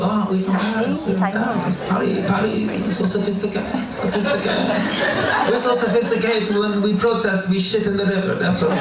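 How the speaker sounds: high, mock snobbish French accent; snooty Parisian.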